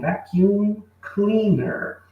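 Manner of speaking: teacher spells out vacuum cleaner slowly for students; slow pronunciation model. Shown as a demonstration. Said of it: "a pronúncia correta é".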